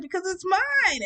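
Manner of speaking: slow, loud, high defiant retort; dramatic defiance. Retold or imitated. Daughter snapping back at mom, dramatic loud defiance. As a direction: say it like a teen storming off, loud and slow. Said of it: "that was me being dramatic".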